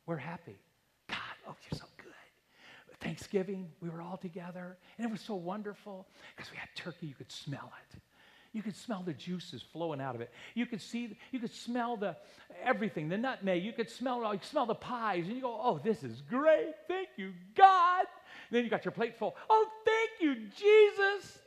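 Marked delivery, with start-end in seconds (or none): 1.1-2.1 breathy hushed whisper of thanks. Pretend. Preacher whispering a pretend prayer of thanks. 15.6-18.1 slow, rising to a loud high cry on God; delighted thanks. Pretend. Preacher acting a thrilled diner thanking God. 19.5-21.4 high, loud exclamation of joy; overjoyed thanks. Pretend. Preacher acting a thrilled diner thanking Jesus.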